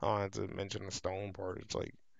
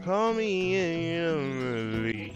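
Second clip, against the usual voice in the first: higher, slow mock sweet voice; high character voice; 7 semitones higher than usual. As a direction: play a sugary character lightly.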